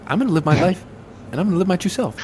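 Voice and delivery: high, quick, nasal character voice; squeaky nasal voice. Acted. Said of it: "I stopped talking so weird and quick, high-pitched, sort of nasally".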